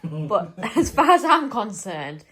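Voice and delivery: laughing while teasing. Real, not acted. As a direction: tease him through a laugh.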